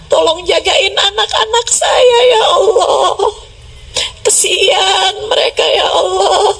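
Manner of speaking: high, crying prayer; tearful pleading. Acted. Preacher acting out a parent praying for the children, tearful plea. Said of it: "Dengan linangan air mata kamu berdoa".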